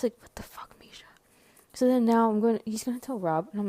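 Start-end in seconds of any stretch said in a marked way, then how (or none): 0.2-1.0 barely audible, voiceless protest; whispered dismay. Retold or imitated. Reacting to Misha telling on the caller, whispered dismay. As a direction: mouth it in a tiny whisper.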